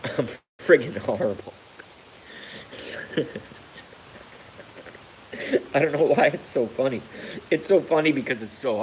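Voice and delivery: ordinary talk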